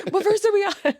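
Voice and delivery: very high, comic holler; retold yelling. Missionary yelling to her companion mid-hymn, high-pitched and laughing. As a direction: call it out high through laughter. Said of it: "yelling at Hermana Bloomfield".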